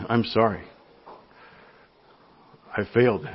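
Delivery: a hushed, humbled "I'm sorry"; quiet apology. Retold or imitated. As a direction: own the failure softly. Apologising to the customer, retold.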